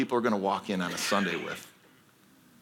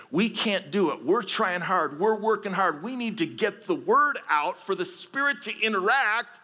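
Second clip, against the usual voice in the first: preaching build; 8 semitones higher than usual. Real, not acted.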